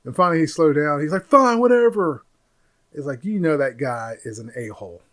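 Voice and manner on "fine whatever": grudging snap. Retold or imitated. Boyfriend gives in grudgingly.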